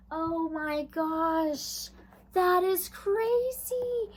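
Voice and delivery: high, slow, drawn-out amazement; amazed. Real, not acted. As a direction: stretch it out in real disbelief. Reacting to the card drawn.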